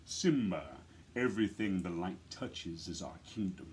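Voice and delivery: deep, low, regal king's voice with a laugh at the end; James Earl Jones voice. Shown as a demonstration. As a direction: speak as a proud father king to his son. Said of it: "I just do my best James Earl Jones impression".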